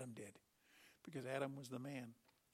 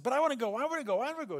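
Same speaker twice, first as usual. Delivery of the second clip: put-on high, loud, childish whine; eager pleading messenger; 9 semitones higher, 11 dB louder, much faster than usual. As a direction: beg like an impatient kid. Eager messenger begging to run.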